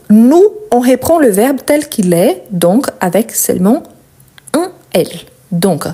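ordinary talk, nothing marked

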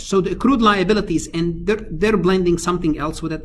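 ordinary talk, nothing marked

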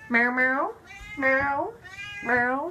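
playful meows with a rising end; cat meow imitation.

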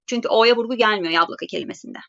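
usual speaking voice

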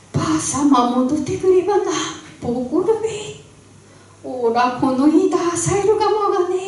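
high, fast worried voice; panicked. Acted. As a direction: fret fast in rising panic. Bride panics, granny returning. (高く早口で慌てる嫁)

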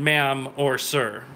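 ordinary talk

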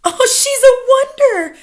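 very high, delighted gush; adoring crying out. A narrator's reading. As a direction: gush over your leader. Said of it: "cried the audience".

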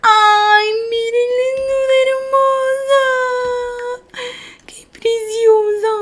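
very high, stretched-out squealy coo; delighted gushing. Real, not acted. Streamer showing viewers a dressed-up pet, squealing adoration. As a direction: gush in a tiny high voice, overcome by cuteness.

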